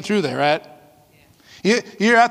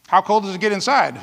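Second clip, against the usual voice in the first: ordinary delivery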